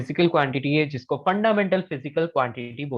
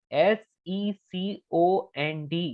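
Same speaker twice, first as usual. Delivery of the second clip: letter-by-letter spelling; 4 semitones higher, much slower than usual. Shown as a demonstration. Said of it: "spelling out second".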